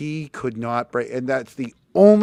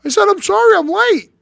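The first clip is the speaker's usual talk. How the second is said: much higher and louder, frightened pleading; scared kid; 10 dB louder than usual. Retold or imitated. Boy pinned to the wall answers his father, high frightened pleading. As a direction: squeal it out like a cornered kid.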